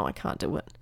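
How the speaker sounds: low, hushed little give-up; defeated muttering. Retold or imitated. Boy at the hilltop without a pep talk, defeated muttering. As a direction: drop it low and under the breath.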